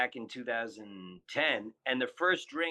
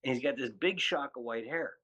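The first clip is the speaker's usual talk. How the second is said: ordinary talk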